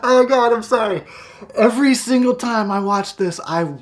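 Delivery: high voice still full of real laughter; recovering from laughter. Real, not acted. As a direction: catch yourself after a laughing fit. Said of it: "burst out laughing".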